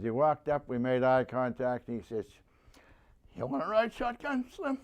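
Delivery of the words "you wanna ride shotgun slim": higher-pitched retold offer from his buddy; lightly voiced Banner, casual and inviting; voicing buddy Banner. Banner asking his buddy along, in a higher casual voice.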